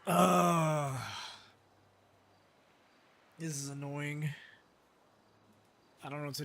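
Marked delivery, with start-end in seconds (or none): 0.1-1.0 loud, exasperated ugh; fed-up groan at the stuck car; frustrated groan. Real, not acted. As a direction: let the annoyance burst out.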